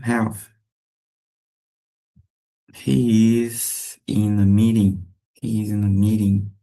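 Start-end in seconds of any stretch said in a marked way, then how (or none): 2.7-5.0 stretched "He is", then a small pause; slow, deliberate language-drill delivery; slow model sentence. Shown as a demonstration. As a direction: draw it out for learners. Teacher models slowly, then at usual pace.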